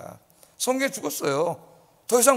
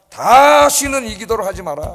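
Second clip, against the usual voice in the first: stern command; 3 semitones higher, 10 dB louder than usual. Retold or imitated. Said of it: "하나님 뭐라 했는지 아십니까".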